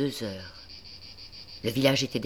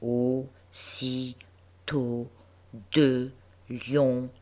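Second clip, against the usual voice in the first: slow, halting, solemn schoolchild reading; a touch of a serious little girl reading aloud; grave child reading; much slower than usual. A narrator's reading. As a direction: spell out the words gravely, one by one. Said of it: "continuait sa lecture d'un air grave".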